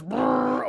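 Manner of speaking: high trilled payout noise; imitated machine noise. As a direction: trill like a machine pouring out coins.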